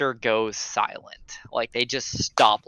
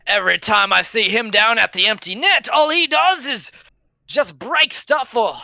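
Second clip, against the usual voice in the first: scornful guard; 5 semitones higher than usual. Acted. Guard scoffs at Eleander to the player, high scornful. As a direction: sneer at the fool.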